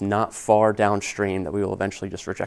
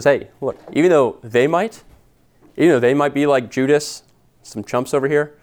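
brash boast, pitched up and louder; Peter; 4 semitones higher, 5 dB louder than usual. Acted. Peter dismissing other disciples to Jesus, brash. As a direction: brush off the others with cocky confidence. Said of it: "Peter's always been brash and quick to speak his mind".

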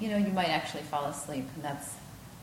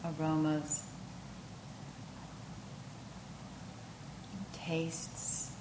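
unhurried yoga nidra voice with long pauses; guided meditation; 3 semitones higher, 4 dB quieter than usual. Acted.

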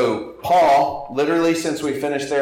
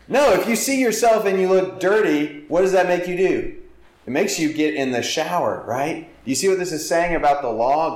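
rising fervor; 7 semitones higher than usual. Real, not acted. Preacher presses congregation about mirror and shower, fervent. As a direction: drive the point home with energy. Preacher builds to emphatic point.

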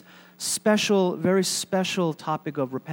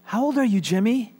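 higher, coaxing question; telemarketer to child; 5 semitones higher, 3 dB louder than usual. Acted. Telemarketer asks little boy his age, pitched up. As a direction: talk down to a small child, pitched up. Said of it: "And he said".

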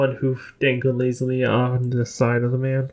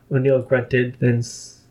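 ordinary delivery, nothing marked

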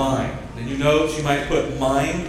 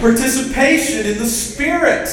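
higher, louder rousing preaching; preacher's call for amen; 10 semitones higher, 4 dB louder than usual. Shown as a demonstration. Preacher drawing an amen from church, loud and high. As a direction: pump it up for the congregation to answer. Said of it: "a preacher like Paul could say it like this".

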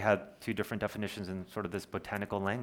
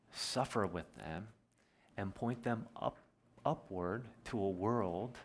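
softer, slow and tender; a softer, tender pastoral appeal; tender and quiet; 5 dB quieter, slower than usual. Acted. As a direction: draw them in gently, almost hushed. Tender sermon climax.

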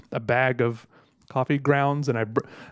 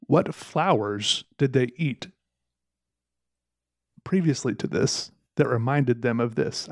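ordinary delivery

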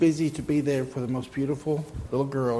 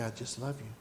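soft, hushed loving words; hushed and tender; 2 semitones lower, 8 dB quieter than usual. Acted. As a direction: breathe it gently. Voicing God's tender words.

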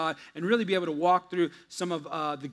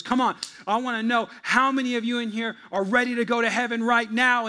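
higher, louder preacher's altar call; 4 semitones higher, 5 dB louder than usual. Retold or imitated. Preacher calling the church to heaven, raised and loud. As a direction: hype up the crowd like a sweating preacher. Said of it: "and he goes".

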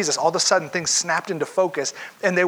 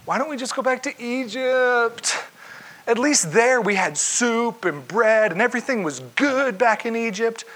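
higher, whiny mock complaint; whiny complaining Israelites; 7 semitones higher than usual. Israelites complain to Moses about Egypt, mock whine. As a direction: mimic grumbling Israelites wanting Egypt back.

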